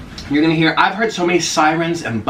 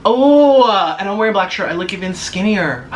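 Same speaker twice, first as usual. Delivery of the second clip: usual speaking voice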